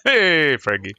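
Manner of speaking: loud, high call falling away on the name. Real, not acted. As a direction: hail someone loudly. Calling out to Frankie.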